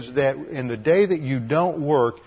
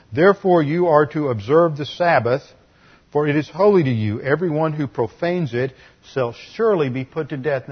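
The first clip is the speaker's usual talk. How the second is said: louder, solemn reading of the verse; Scripture reading; 3 semitones higher, 5 dB louder than usual. Acted. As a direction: proclaim the commandment from the pulpit. Preacher reads Scripture aloud.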